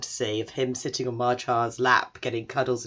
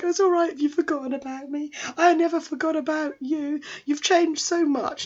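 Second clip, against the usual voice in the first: very high, wistful little voice; hammed-up brave face through tears; mock tearful; 14 semitones higher than usual. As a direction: act tearily forgiving, pitched way up. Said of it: "it's all tearing up".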